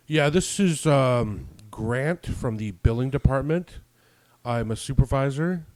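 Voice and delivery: pompous caller trying to scare you; self-important billing supervisor. Retold or imitated. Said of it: "they always try to scare you".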